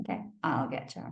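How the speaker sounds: low, quick, quiet promise; quiet inner vow, pitched low; low muttered vow. Retold or imitated. Repeated threat, lower voice.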